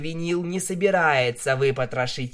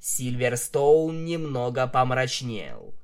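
ordinary delivery

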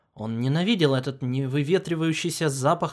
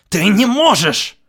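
loud, slow shout; 6 semitones higher, 12 dB louder than usual. A narrator's reading. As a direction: yell it in horror. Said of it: "заорал он".

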